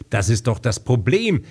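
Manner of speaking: usual speaking voice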